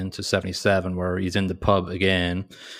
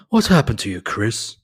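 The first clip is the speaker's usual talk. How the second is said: mock Tony line; 3 semitones higher, 5 dB louder than usual. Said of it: "another trope quote from Tony".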